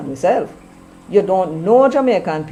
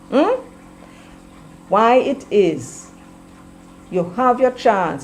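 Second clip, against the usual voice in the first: ordinary delivery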